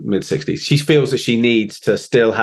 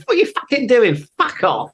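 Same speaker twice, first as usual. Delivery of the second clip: imitating an angry boss; 12 semitones higher than usual. Singer swearing at her drummer mid-gig, hammed-up furious. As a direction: throw out her exasperated swearing as a caricature. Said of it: "She goes".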